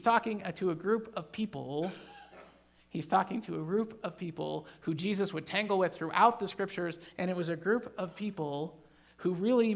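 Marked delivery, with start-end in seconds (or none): none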